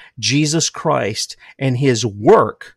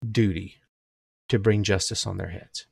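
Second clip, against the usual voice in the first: dropping to a hush; 5 semitones lower, 10 dB quieter than usual. Real, not acted. Repeat, dropping to soft.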